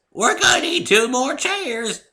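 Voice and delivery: high, slow creaky granny call; hollering old mare; creaky old woman. A narrator's reading. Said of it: "called the voice".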